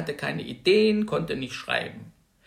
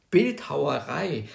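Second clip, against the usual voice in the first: usual speaking voice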